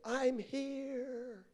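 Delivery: high, soft, drawn-out answer; mysterious distant voice. Retold or imitated. God answering man on cliff, high soft call. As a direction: imitate a faraway voice floating up.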